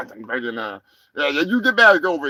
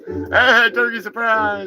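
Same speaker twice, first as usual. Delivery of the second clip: ordinary talk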